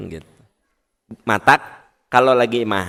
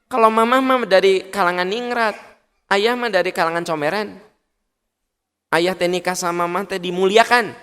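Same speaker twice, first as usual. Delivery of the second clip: indignant wife; 6 semitones higher than usual. Acted. Wife scolding husband, acted quarrel.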